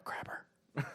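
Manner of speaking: whispered secret coaching; hushed mock urging; conspiratorial whisper. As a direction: whisper it like a sly prompt. Pretends secretly coaching the boy.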